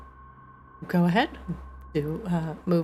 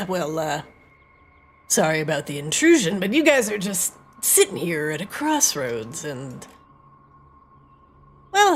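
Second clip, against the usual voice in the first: sly bandit; 7 semitones higher, 6 dB louder than usual. Acted. GM voices a roadside bandit.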